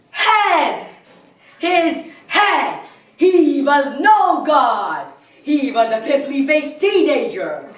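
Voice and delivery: high, loud melodramatic cries; outraged horror. Acted. Villain learns his god was a pimply-faced teenager, outraged. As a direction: cry out the betrayal in theatrical outrage. Outraged revelation of shattered idol.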